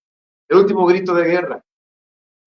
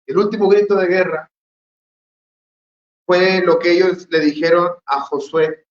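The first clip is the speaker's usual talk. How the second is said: ordinary talk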